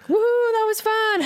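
very high whooping cheer; drunk cheer. Retold or imitated. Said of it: "he and his buddies were like".

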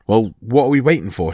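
ordinary delivery, nothing marked

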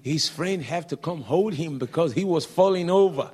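excited exclaim. Real, not acted. Preacher describing the man falling under power, excited. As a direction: build it up with excitement.

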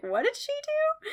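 high-pitched mock bafflement; all confused. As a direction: squeak the question as a bewildered parent. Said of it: "all confused".

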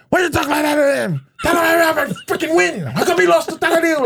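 high, shrill-pitched mock yelling of an angry mother; imitating mom yelling at him for losing. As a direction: belt it out like an outraged mom scolding her son. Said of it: "she's yelling at me".

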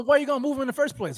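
fast, high-pitched protest; heated challenge. Real, not acted. Co-host demanding why anyone moved an injured player, heated challenge. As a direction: demand an answer in exasperation.

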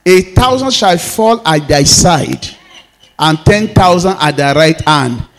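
loud, rising sermon declarations over music; full-force preaching. Acted. Pastor declaring protection over the church at full force. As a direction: proclaim it to the congregation at full force.

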